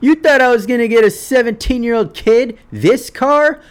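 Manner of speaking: high, loud jeering dad; mocking laugh. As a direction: laugh in his face with a cruel mock. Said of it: "laugh at the end and be like".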